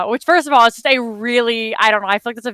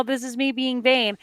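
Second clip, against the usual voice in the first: ordinary talk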